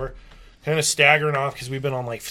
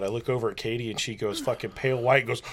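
normal talking voice

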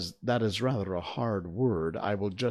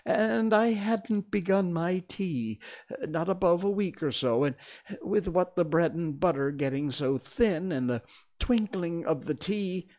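high, nervous, faltering account; quavering, high-pitched rambling; trembling voice; 8 semitones higher than usual. A narrator's reading. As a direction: stammer out your excuse in a high voice. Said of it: "began in a trembling voice".

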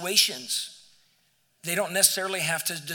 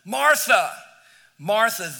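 louder, higher call to cut through her tizzy; raised attention-getting call; 3 semitones higher, 7 dB louder, slower than usual. Acted. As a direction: call her name to stop her short. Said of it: "said her name twice just to get her attention".

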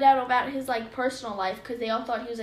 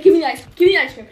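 dad demanding; 4 semitones higher, 13 dB louder, faster than usual. Retold or imitated. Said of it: "he's like".

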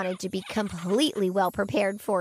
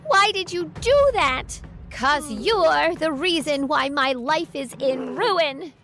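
raised-pitch, accusing outburst; angry yell; 4 semitones higher than usual. Acted. Daughter confronting her mother over the plot, yelling in anger. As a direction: confront her angrily, voice pushed high. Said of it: "I yelled at her in anger".

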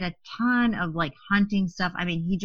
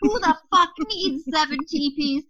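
much higher, incredulous angry question; exasperated outburst; 10 semitones higher than usual. Retold or imitated. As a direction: throw up your hands at it, high. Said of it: "and just pissed".

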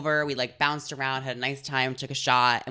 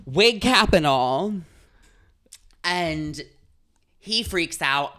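ordinary delivery

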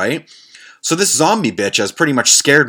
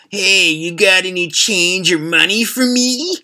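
slow, higher plea for change, hammed-up crackhead; slow, higher beggar voice; 9 semitones higher, slower than usual. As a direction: whine it out slowly like a street beggar. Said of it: "and she says".

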